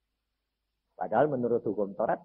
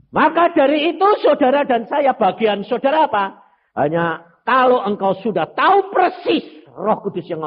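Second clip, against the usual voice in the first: preaching; 13 semitones higher, 9 dB louder than usual. Acted. Preaching at full force.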